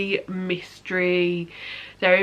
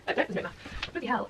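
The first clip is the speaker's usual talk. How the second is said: usual speaking voice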